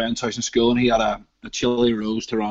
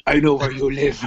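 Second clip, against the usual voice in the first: Liam Neeson; 5 dB louder than usual. Pretend. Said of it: "okay, Liam Neeson, relax".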